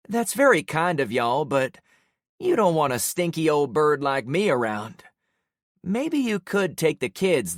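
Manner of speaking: lightly voiced frail old lady; a weary, kindly old woman. A narrator's reading. Old woman's lines in story.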